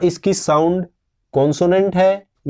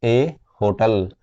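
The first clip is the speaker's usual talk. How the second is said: slow answer; 4 semitones lower, much slower than usual. Shown as a demonstration. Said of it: "तो हम क्या use करेंगे".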